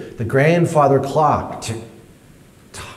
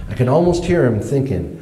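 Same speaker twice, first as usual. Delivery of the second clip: ordinary delivery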